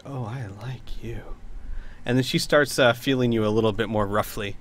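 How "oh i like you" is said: slow, soft, whispered approval; grinning whisper. Acted. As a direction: whisper it slowly through a grin. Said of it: "looks at you seriously and then grins".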